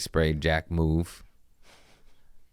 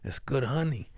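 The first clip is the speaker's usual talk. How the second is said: unvoiced conspiratorial whisper; whispered bribe; 7 semitones higher, 7 dB quieter than usual. To the customs officer he tries to bribe, a sly whisper. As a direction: whisper it like a secret deal.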